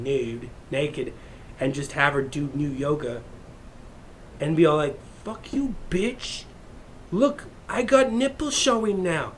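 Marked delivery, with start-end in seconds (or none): 5.2-9.3 put-on high taunting girl voice. Voicing defiant nude yoga babe.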